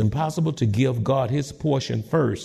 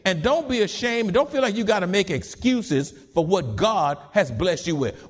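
usual speaking voice

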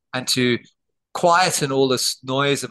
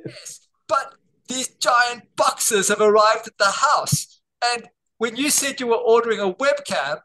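mock wife's outrage; 8 semitones higher than usual. Wife scolding husband about giant monitors, high mock outrage. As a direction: play the scandalised wife at the start, higher voice. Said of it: "I was like".

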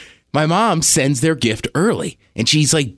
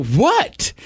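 confused what; 8 semitones higher than usual. Retold or imitated. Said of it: "And I go".

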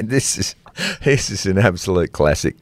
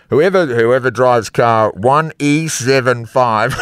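mock loudspeaker announcement; slower than usual. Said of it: "put over the loudspeaker".

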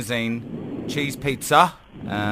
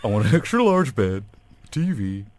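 movie dad voice. Pretend. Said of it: "This is Peter McAllister".

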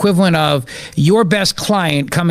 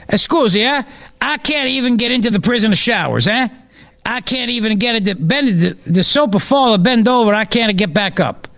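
higher-pitched comic Italian prisoner; mock Italian accent; 5 semitones higher than usual. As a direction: whine your case in hammed-up Italian English. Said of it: "fat ass Italian who was released from prison".

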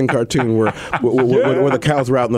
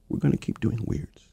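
soft whispered aside; 10 dB quieter than usual. Real, not acted. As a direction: whisper it conspiratorially. Drops to whisper.

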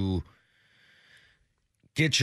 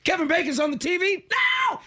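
high, shrill-pitched fake panic; mock panic. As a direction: squeal it like a frightened kid. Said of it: "now I'm just like".

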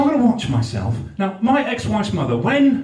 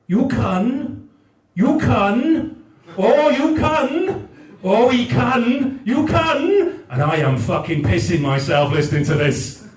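Newport granny's coaxing climbing higher and higher; Newport accent; 7 semitones higher than usual. Retold or imitated. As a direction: Welsh Newport nan cooing, pitch rising to a squeal. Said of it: "I'm gonna do the accent".

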